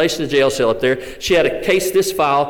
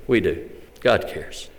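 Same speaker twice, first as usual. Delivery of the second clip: much lower and softer, reassuring; quiet sincere answer; 7 semitones lower, 5 dB quieter, slower than usual. Acted. Preacher answering his own question to the church, softly sincere. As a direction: drop it to a gentle answer.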